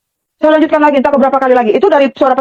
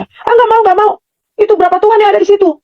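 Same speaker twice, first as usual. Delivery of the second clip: high-pitched retold refusal, emphatic; inner protest; 9 semitones higher than usual. Woman silently refusing the voice's order, retold as high, emphatic protest. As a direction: push back firmly in a raised pitch. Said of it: "saya bicara tuh nggak keras begini".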